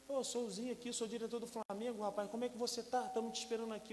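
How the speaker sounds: high, hearty caller voice; cheerful director. Retold or imitated. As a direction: greet him like a friendly boss.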